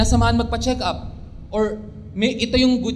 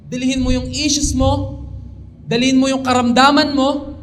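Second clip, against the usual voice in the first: rising altar call; 4 semitones higher, 4 dB louder than usual. Real, not acted. Preacher's call building up.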